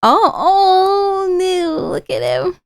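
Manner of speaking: hammed-up whimpering cry, high then dropping; mock weeping in sympathy for sad Cole. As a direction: pretend to sob over him. Mock crying over sad Cole.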